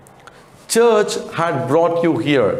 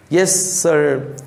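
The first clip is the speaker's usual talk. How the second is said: put-on stammering boy; 3 semitones higher than usual. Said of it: "stammered the boy".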